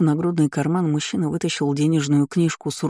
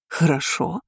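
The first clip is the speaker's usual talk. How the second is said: incredulous echo; slower than usual. A narrator's reading.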